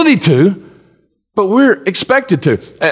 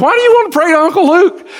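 incredulous; 10 semitones higher than usual. Real, not acted. Preacher's incredulous rhetorical question.

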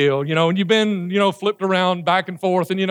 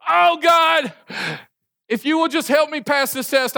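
desperate prayer; 8 semitones higher, slower than usual. Acted. Student on Monday begging God before a test, hammed-up plea. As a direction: beg God like a cramming student. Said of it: "you're like".

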